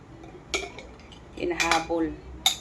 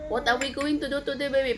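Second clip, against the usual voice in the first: put-on baby talk; 7 semitones higher, faster than usual. Cooing to her baby.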